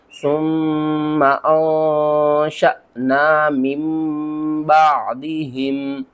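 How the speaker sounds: slow tajweed chant, noon merged into meem; Quran recitation. Shown as a demonstration. Teacher shows correct noon-to-meem reading, slow chant. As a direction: recite slowly, reading "मिन बादिहिम" as meem. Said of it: "तो इसको किस तरह पढ़ना है?".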